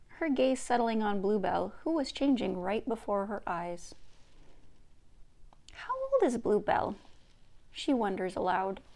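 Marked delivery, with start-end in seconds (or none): 5.7-7.0 put-on higher, curious girl's voice; a little girl asking out of wonder; young Gidgee.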